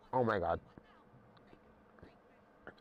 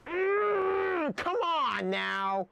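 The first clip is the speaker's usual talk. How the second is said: frustrated whine; much slower than usual. Real, not acted. Frustrated cry at game.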